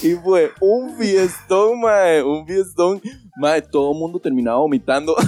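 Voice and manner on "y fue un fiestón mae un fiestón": drawn-out excitement. Real, not acted. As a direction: stretch "fiestón" out slowly and high. Excitedly bragging about the party.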